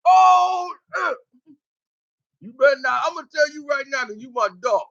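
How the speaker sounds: put-on a high, excited warning to his boy; outraged yell. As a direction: keep hollering, then rush the warning. Homeboy still ranting at friend.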